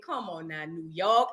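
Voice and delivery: low, soft exasperated; quiet groan. Retold or imitated. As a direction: sigh it out low. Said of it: "I said".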